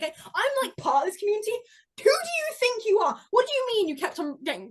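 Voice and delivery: high-pitched affronted ranting; hammed-up outrage; mock indignation. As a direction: confront an absent rival, pitch climbing. Mock argument with absent commenter.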